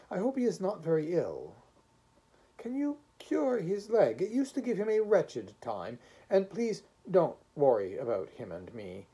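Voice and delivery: lightly voiced anxious little man asking a favour.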